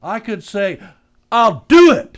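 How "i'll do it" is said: bursts out loud with a sudden idea; excited shout. Acted. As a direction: shout it out, triumphant.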